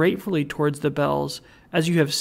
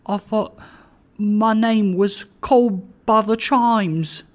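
put-on higher-pitched, humble old-fashioned pleading; supplicating Trotty; 6 semitones higher, 4 dB louder than usual. A narrator's reading. Trotty pleads with the chimes, supplicating. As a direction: plead upward to a power above you. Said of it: "in an attitude of supplication".

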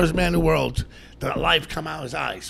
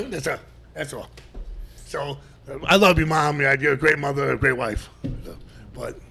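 ordinary talk, nothing marked

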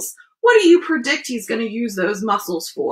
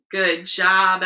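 plain reading voice

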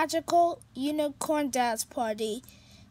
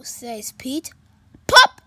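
ordinary delivery, nothing marked

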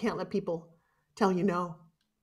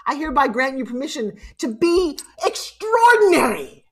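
rousing proclamation; 3 semitones higher, 5 dB louder than usual. Real, not acted. Speaker granting her audience permission to be extraordinary, rousing proclamation. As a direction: proclaim it big, soaring on the last word. Grand climax of permission declaration.

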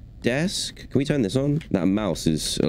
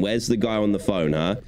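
ordinary talk, nothing marked

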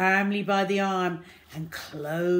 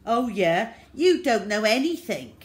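put-on loud, higher boy's comeback; defiant retort; 5 semitones higher, 8 dB louder than usual. A narrator's reading. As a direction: fling it back at her. Boy snaps back at taunt.

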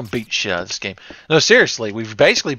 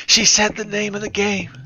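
higher, gleeful emphasis on said; excited repeat; 9 semitones higher than usual. Real, not acted. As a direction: punch "said" with glee.

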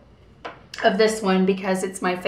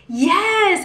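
eager yes; 10 semitones higher than usual. Retold or imitated. Eager agreement in retold chat.

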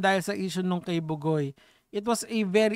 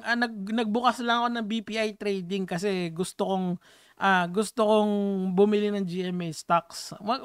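ordinary talk, nothing marked

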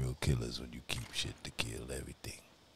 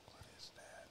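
soft whisper; 6 dB quieter than usual. Real, not acted. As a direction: whisper while looting. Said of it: "I don't know why I'm whispering".

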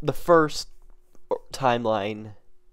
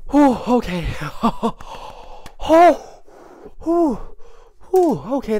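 breathless relief; 10 semitones higher, 5 dB louder than usual. Real, not acted. Catching breath after excitement.

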